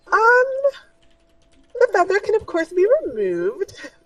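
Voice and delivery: a dainty merchant offering a fix; high shopkeeper voice. Acted. Shopkeeper assuring the party, high and fussy.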